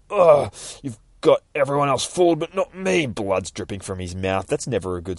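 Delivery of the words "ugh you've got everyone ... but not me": higher, strained retort; pained defiance. Acted. Wounded Nightwing defying the judge, pained defiance. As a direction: push it out through the pain. Stabbed hero defies the villain.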